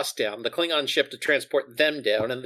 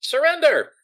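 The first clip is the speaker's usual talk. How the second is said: high, raised call to surrender; mock-official yell into a cave; yelled demand; 11 semitones higher, 4 dB louder than usual. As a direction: call it out high, falling at the end. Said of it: "we'll just yell out".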